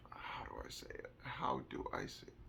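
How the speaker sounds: hushed, near-whispered aside to oneself; thinking aloud. Real, not acted. Speaker searching for how to describe a meltdown, murmuring to self. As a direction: murmur it under your breath while searching for words.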